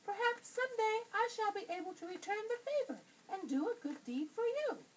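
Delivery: high, squeaky little mouse voice; tiny frightened mouse squeaking. A narrator's reading. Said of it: "cried the little mouse".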